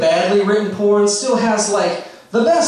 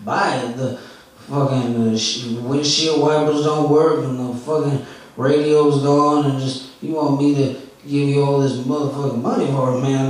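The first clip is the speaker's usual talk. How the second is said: impression of stoned comic; 4 semitones lower than usual. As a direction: sink into a dazed, nodding-off junkie voice. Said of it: "I'm going to do his set for you right now, verbatim".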